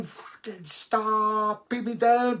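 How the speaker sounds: high desperate yell up to the boat; panicked yell. Retold or imitated. Singer dangling from a kite yelling at the boat below, high panicked shout. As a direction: yell to be put down, high and strained.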